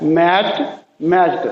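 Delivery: मैच्ड ending in t, matcht. Shown as a demonstration. Teacher modelling the t ending of मैच्ड for the class. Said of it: "टी की आवाज़ बनी है".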